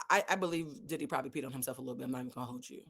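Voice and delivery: ordinary talk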